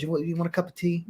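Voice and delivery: lightly voiced fussy host offering tea; a hint of a prim tea-offering friend; imitating Chris. Imitating Chris offering tea again, lightly voiced. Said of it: "That was my favorite line".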